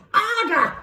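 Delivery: high, loud, urging shriek; Missus Pratchett. A narrator's reading. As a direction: shriek for a harder blow. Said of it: "shrieked Missus Pratchett".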